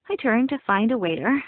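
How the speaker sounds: usual speaking voice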